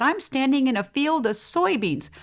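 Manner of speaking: high, wondering disbelief; mock amazement at a field of soybeans; amazed tourist. Tourist amazed at the soybean field, high and bemused. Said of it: "And she said".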